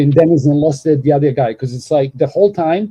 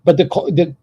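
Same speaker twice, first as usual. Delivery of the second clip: ordinary talk, nothing marked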